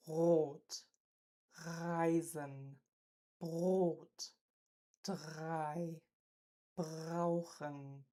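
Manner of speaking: Rot, Reisen, Brot, drei, brauchen with a rolled uvular R; R in Rot, Reisen, Brot, drei, brauchen trilled at the back. Shown as a demonstration. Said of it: "The uvular trill".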